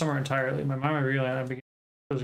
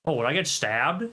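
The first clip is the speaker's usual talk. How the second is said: normal talking voice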